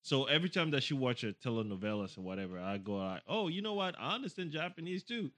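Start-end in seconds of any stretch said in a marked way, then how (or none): 3.3-5.3 higher-pitched mild boast; showing off. Retold or imitated. Husband bragging to his wife over her telenovelas, showing off. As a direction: casually brag in a higher voice.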